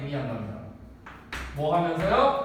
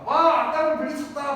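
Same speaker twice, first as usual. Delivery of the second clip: put-on louder, higher gleeful kid shout; triumphant child cheer; 7 semitones higher, 5 dB louder than usual. Son cheering his win over dad's villain, louder gleeful shout. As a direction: crow like a little hero who just won. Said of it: "그럼 얘가".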